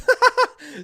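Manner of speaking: very high, loud laugh. Real, not acted. Teller amused that Cersei gets cold, real laughing. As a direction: crack up helplessly.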